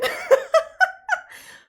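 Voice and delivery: high burst of loud laughter. Real, not acted. Laughing after mocking herself as a clueless American. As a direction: crack up at your own bit. Laughs at her own joke.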